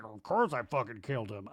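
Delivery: low muttered private admission; mock hot-mic confession. Guy alone in bathroom admitting it, muttered. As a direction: confess it to yourself, offhand. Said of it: "He's like".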